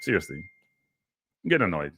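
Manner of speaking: low, subdued irritation; cranky and fed up; real annoyance. Real, not acted. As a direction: grumble it, sinking in pitch. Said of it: "starting to get back to that old cranky".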